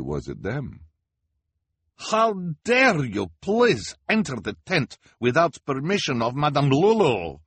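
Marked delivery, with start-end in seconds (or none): 2.1-7.4 high, loud, fake foreign fortune-teller accent; outraged carnival boss in a put-on accent; cried in Lulu's fake accent. A narrator's reading. Madame Lulu scolds the orphans, high loud fake accent. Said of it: "Madame Lulu cried".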